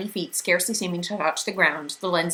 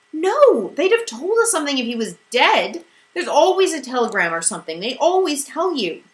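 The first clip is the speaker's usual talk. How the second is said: higher, much louder cry of protest; desperate denial; 9 semitones higher, 7 dB louder than usual. A narrator's reading. Meg refusing to believe her father is dead, loud protest. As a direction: shout it to push away the fear.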